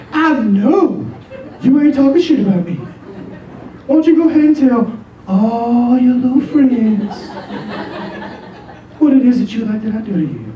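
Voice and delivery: high, sassy, mock-furious girlfriend voice; hammed-up neck-rolling challenge; sassy finger-talker. Girlfriend storming back to confront John, high sassy fury. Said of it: "finger talkers".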